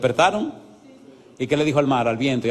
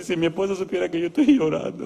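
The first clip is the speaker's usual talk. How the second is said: tearful; 3 semitones higher than usual. Retold or imitated. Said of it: "empezó a llorar".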